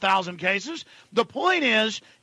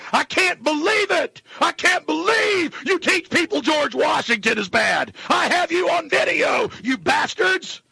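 angry disbelief; 6 semitones higher than usual. Real, not acted. Host yelling at the government he has on video, furious shouting. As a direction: rail at them with real outrage.

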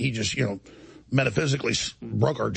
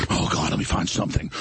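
breathy mock whisper of a schemer; 11 semitones lower than usual. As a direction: hiss it like a plotter. Mocking the host as a victim.

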